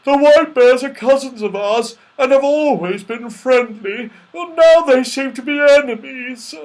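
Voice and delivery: high, loud, plaintive bear lament; mournful bear. A narrator's reading. Father Bear laments falling out with cousins, mournful. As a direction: bemoan it in a bear voice. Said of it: "said the Father Bear mournfully".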